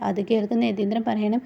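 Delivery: ordinary delivery, nothing marked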